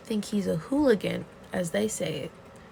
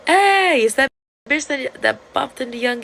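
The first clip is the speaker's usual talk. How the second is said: calling out; 6 semitones higher, 11 dB louder than usual. Retold or imitated. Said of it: "Sushi's like".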